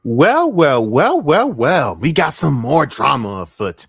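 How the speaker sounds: high, loud, drawn-out "well, well" opener; theatrical gloating. Acted. Streamer opening a new drama video, theatrical gloating. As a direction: relish each word slowly and loudly, like trouble has arrived.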